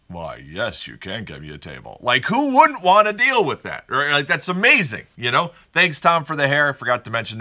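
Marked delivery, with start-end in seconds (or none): 0.1-2.0 lordly reply. Retold or imitated. Regular answers the steakhouse host, low and lordly. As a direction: lower and much softer, grandly pleased. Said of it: "You'd be like".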